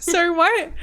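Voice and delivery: puzzled clerk who can't catch the word; mock English waitress. English waitress failing to understand an American, mock puzzled. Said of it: "the woman was like".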